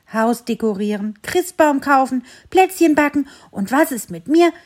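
dog complains his family has no time, angrily; angry ranting. Acted. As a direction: work himself up into a fury. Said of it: "Bello hatte sich richtig wütend geschimpft".

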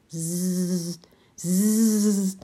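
quiet zzz buzzing of a ladybird; hushed insect buzz; soft buzzing. A narrator's reading. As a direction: buzz softly like a small insect. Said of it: "summt es leise durch die Luft".

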